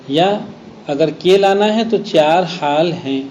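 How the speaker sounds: usual speaking voice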